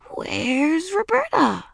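high, drawn-out singsong peekaboo call; forced cheer. A narrator's reading. Ramona playing peekaboo to stop Roberta crying, forced cheer. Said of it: "She said as cheerfully as she could manage when she was so frightened".

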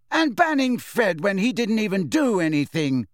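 high, indignant outburst; furious. A narrator's reading. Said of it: "said Alicia furiously".

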